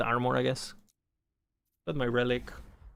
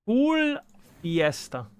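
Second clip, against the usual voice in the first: hyped shout; 9 semitones higher, 6 dB louder, much slower than usual. Real, not acted. Streamer hyping the fiesta event.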